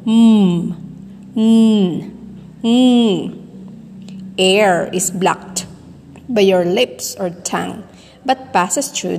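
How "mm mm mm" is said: sustained nasal consonant hums, evenly spaced; demonstrated humming. Shown as a demonstration. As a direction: hum each sound for students to copy. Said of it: "Say this with me".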